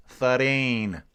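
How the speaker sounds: a light mock Australian accent on the numbers; imitating an Australian accent. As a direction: count it like an Aussie, half-joking. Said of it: "It's kinda like the Australians".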